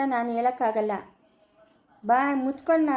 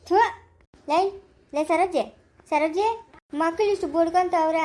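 calling out; 3 semitones higher than usual. Acted. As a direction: shout her awake across the house. Calling out to Saroji.

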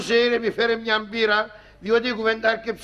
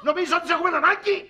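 mock scolding shout; 7 semitones higher, faster than usual. Friend scolding the father.

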